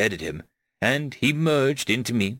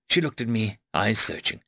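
usual speaking voice

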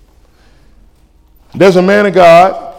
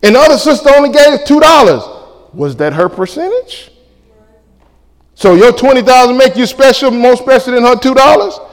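higher, louder preaching call; preaching shout; 7 semitones higher, 4 dB louder than usual. Acted. Preacher rebukes the congregation, louder and higher. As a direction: drive it home from the pulpit.

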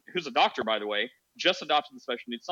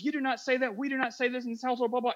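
mock indignant scolding; 9 semitones higher, faster than usual.

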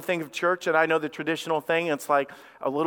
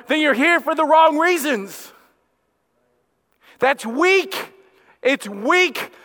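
loud, higher-pitched preacher building to a hard warning; full-force preaching; 6 semitones higher, 7 dB louder than usual. Real, not acted. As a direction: drive it home to the congregation, loud and high.

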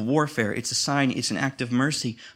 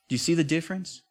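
ordinary delivery

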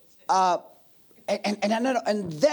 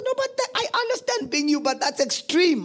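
wife's objection; 10 semitones higher than usual. Retold or imitated. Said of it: "and then she says".

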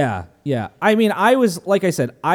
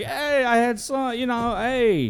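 mock Rocky excuse; 9 semitones higher than usual.

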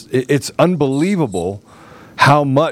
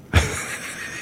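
breathy burst of laughter; short laugh; 8 dB quieter than usual. Real, not acted. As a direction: laugh at the absurd ticket. Laughs at own story.

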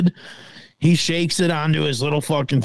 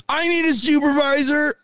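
much higher, mocking voice for the nervous kid; mock young agent; 12 semitones higher than usual. Mocking the TSA kid asking for his supervisor, much higher mock voice. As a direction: ham up the rookie agent calling for backup.

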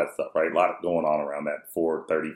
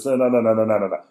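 raised, rapid string of refusals; frantic cutting-off; 3 semitones higher, 4 dB louder, faster than usual. Retold or imitated. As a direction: hurry to shut the kid up. Dad frantically cutting son off.

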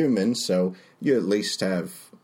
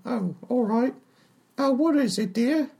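higher chatty tavern barmaid. Acted. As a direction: play the friendly, simple barmaid. GM voices Tallulah the barmaid.

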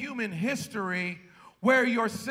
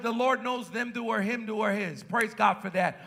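ordinary talk

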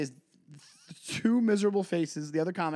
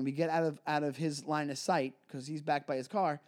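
ordinary delivery

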